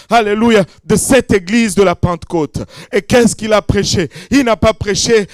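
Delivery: loud, rising pentecostal preaching; hammering sermon cadence; full-force preaching. Acted. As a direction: preach it out to the crowd with fire.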